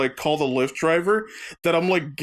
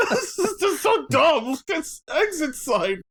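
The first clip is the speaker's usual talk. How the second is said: put-on high, slurred drunken griping; tipsy complaint, higher pitch; drunk scorn; 8 semitones higher than usual. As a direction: whine at the sign like a tipsy passenger. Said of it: "And I go".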